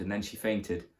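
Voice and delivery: ordinary delivery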